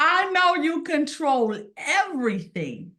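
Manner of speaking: fervent appeal to God, high and deliberate; fervent prayer. Retold or imitated. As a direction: plead with God, voice lifted and slow. Praying to God, pleading.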